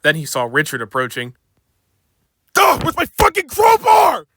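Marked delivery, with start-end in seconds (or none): 2.6-4.2 angry shout. Acted. James enraged.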